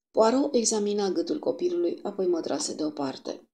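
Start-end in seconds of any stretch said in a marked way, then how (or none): none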